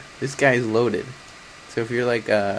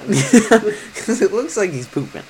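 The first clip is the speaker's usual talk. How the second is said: laughing; 9 semitones higher, 5 dB louder, slower than usual. Real, not acted. Laughing at the poop crown.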